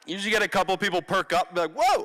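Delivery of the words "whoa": sudden high excited whoa. Retold or imitated. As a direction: blurt it out like an amazed kid. Kids react to phone number.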